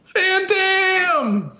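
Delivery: high, drawn-out falsetto yell; screamed battle cry. Acted. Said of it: "and then screams out".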